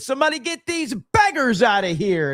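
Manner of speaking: high-pitched yell held at moderate volume; retold yell. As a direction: re-enact hollering it out, pitch way up. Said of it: "I just said it as loud as I could".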